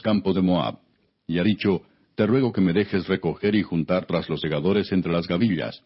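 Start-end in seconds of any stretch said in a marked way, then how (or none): none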